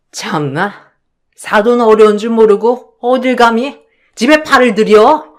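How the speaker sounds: loud, indignant and scornful; jump, then sneer her away; startled mother-in-law. Acted. Mother-in-law snapping back at the intruding mother, indignant.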